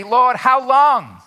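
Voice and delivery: slow, loud, high plea; newcomer's tired prayer; weary lament. Acted. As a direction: drag it out in frustration. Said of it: "and you say".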